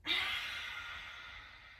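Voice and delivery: long, voiceless whooshing sigh; lion's breath exhale. Shown as a demonstration. Teacher repeats lion's breath after big breath in, long exhale. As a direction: let all the air rush out. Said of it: "let's do lion's breath".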